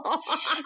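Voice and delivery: quick high laugh; a brief amused laugh; short laugh. Real, not acted. Laughing at her friend's rayuma joke, quick laugh.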